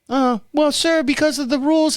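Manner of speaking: put-on high, girlish rep voice; little girl on phone. Bank rep asking caller to verify, high little-girl voice. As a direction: rattle off verification in a girlish pitch. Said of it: "I get this little girl on the phone".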